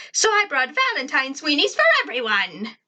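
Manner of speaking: high, put-on girl voice; Tack. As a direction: announce a treat to the class in a high character voice. Tack's high character voice.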